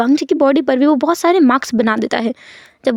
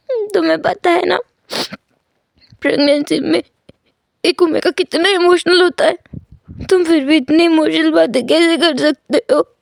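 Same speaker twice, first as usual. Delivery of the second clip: tearful complaint; 4 semitones higher than usual. Acted. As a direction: pout it out, close to tears. Pregnant Wing scolds tearfully.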